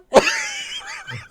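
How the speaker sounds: high burst of delighted laughter. Real, not acted. Delighted laugh after impression.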